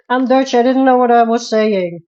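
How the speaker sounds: laughing it off, high and loud; cackling at her own mistake; embarrassed laughter. Real, not acted. Streamer laughing at her mangled R to chat.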